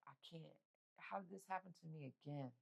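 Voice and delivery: very soft, defeated and tearful; broken whisper-quiet. Retold or imitated. Fallen to the floor crying, a very soft broken plea. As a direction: let it fall apart in despair.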